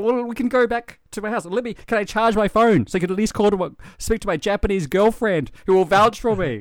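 high, loud, rushed pleading to be let go; frantic pleading. Retold or imitated. Drunk foreigner begging police for a call, frantic. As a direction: beg them to let you charge the phone. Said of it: "I said".

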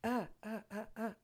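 soft laughing. Real, not acted. Cracking up mid-sentence.

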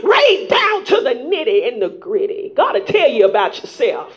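chanted, fast, rhythmic preaching with music; preaching at full force. Acted. Preacher urging the congregation to grow up, whooping. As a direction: whoop it over the organ.

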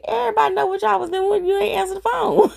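high-pitched mock dad announcement; imitated teasing. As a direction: mimic dad calling them out, high-pitched. Dad teasing the couple, retold.

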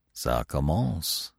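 low, soft, slow murmur. A narrator's reading. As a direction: murmur it drawn out under the breath. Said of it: "he murmured".

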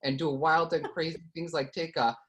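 put-on Midwestern mom voice. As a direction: riff as Kathy, a Midwestern mom. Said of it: "Western mom who I think people liked".

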